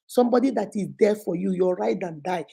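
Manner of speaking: normal talking voice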